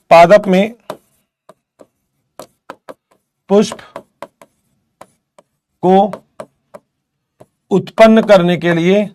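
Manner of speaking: slow, word-by-word dictation with long pauses; teacher spelling out a note at writing pace. Acted. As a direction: dictate each word so students can write it down. Dictates notes to students.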